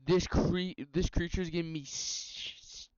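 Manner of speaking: broken false starts, stuck on the s; stuttering. Real, not acted. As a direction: stumble and stall on the words. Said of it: "I'm stuttering".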